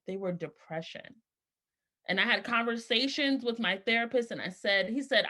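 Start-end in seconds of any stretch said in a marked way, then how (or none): 0.1-1.2 quiet. Real, not acted. Heavy admission, very soft.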